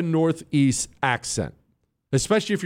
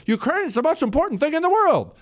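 mocking pompous politician, higher and quicker; 11 semitones higher, faster than usual. Host mocking politicians' Ukraine talk, mock pompous. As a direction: parrot a grandstanding politician, sneering.

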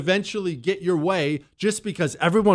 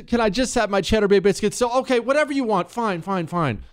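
higher-pitched pleading surrender; exasperated giving in; 7 semitones higher than usual. Acted. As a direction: cave in just to get some peace. Acts worn-down diner giving in.

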